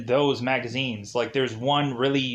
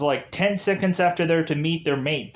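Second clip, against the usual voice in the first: ordinary talk, nothing marked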